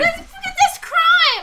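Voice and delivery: high, slow, loud cry of protest; hammed-up indignant yell; mock outraged shout. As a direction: shout it like a scandal. Mock outrage at accent joke.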